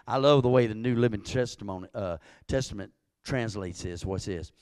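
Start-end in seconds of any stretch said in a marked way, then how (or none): none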